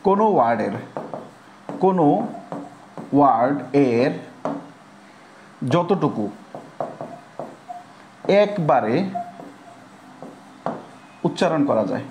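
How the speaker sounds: word by word, long pauses between phrases; dictation pace. Real, not acted. Teacher dictates the definition for writing.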